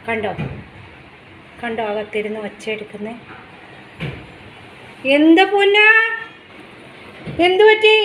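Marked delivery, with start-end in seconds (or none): 5.2-7.9 loud, high call to a beloved pet. Real, not acted. Mother asks noisy pet what's wrong, loud high calling. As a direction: call through to the fussing pet, pitched up.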